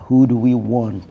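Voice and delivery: usual speaking voice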